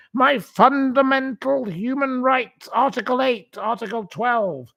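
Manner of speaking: high, self-righteous legal recital; mock pompous reading. Mocking her legal claims to viewers, high and pompous. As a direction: recite the rights like a smug barrack-room lawyer. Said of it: "she goes on and on and on".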